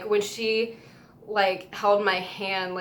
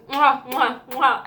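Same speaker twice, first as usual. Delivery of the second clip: blown kisses; 4 semitones higher, 6 dB louder, slower than usual. Retold or imitated. Singer blowing kisses.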